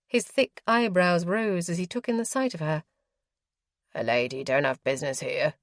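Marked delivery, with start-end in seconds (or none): 3.9-5.5 brusque. A narrator's reading. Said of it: "he said brusquely".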